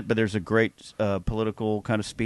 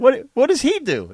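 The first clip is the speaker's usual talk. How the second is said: higher, louder airy voice, clueless; mock clueless star, higher and louder; clueless Gwyneth; 13 semitones higher, 5 dB louder than usual. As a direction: play her clueless on the red carpet. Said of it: "clips of her being interviewed".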